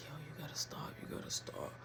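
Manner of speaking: whispered urgent plea; hushed plea, hiding from the house. Retold or imitated. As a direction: breathe it low and urgent. Hushed basement, avoiding being heard.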